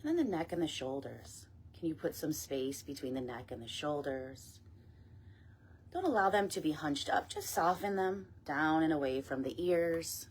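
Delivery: guided meditation. Acted. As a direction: ease them gently down, phrase by phrase. Leads meditation and breath work.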